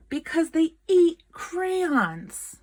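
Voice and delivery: plain reading voice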